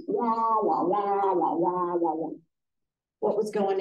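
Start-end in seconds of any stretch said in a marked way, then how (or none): 0.1-2.3 lightly mimicked muffled wah wah; mild mock trombone wah wah; Charlie Brown adult wah-wah. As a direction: imitate the droning cartoon teacher. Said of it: "the adults on the Charlie Brown cartoons".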